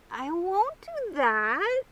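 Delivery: slow, halting promise; hesitant. A narrator's reading. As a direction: drag it out, unsure of yourself. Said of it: "he said hesitantly".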